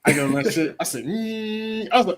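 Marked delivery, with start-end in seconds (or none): 1.0-1.9 high, sustained whining hum; imitated engine "mm". Storyteller acting out riding the mini bike, engine hum. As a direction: hum like a little motor revving.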